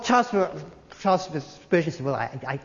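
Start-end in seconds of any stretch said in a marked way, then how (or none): 2.0-2.6 softer, lower, hesitant; a touch of embarrassment; sheepish reply. Retold or imitated. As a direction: mumble an embarrassed answer.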